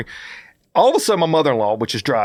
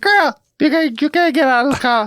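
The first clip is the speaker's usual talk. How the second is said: mom yelling; 13 semitones higher, 3 dB louder than usual. Retold or imitated. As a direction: holler it like a mother scolding her grown daughter, way up high. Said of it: "That's how she talks".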